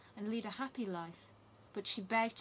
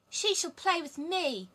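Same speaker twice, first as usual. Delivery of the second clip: put-on high, loud, slow headstrong child; spoiled robber girl; 9 semitones higher, 8 dB louder, slower than usual. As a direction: demand it like a spoiled brat. Spoiled robber girl claims Gerda.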